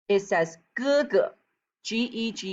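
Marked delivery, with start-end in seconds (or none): 0.8-1.2 "ge ge" with the second syllable short and toneless; "ge ge", second syllable clipped short; neutral tone. Shown as a demonstration. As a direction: make the second "ge" a quick hiccup. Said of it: "pronounced softly and is short like a staccato".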